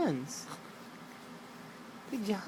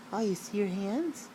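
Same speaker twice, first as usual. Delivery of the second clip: ordinary talk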